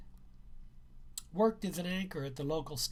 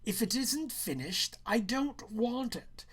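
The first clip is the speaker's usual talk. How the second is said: stern teacher; 6 semitones higher than usual. Acted. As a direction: refuse it flatly, teacher to pupil. Teacher refuses the assignment.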